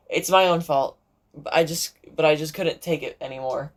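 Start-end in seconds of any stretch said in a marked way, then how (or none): none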